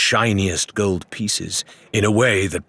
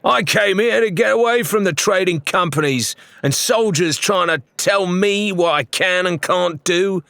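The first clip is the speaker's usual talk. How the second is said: high, loud rebel pirate voice; a pirate who won't take orders; defiant heckler; 11 semitones higher, 3 dB louder than usual. A narrator's reading. Heckler to the tavern, loud and defiant.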